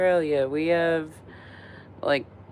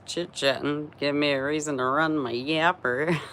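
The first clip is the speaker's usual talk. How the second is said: ordinary delivery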